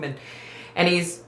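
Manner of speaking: usual speaking voice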